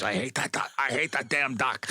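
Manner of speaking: lightly imitating a German accent, fed up; a hint of a German accent, sick of the duck; mock German accent, grumbling. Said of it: "her accent is so bold".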